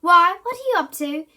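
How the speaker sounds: loud, high suspicious challenge; suspicious sister. A narrator's reading. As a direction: demand it sharply. Susie suspicious of brother.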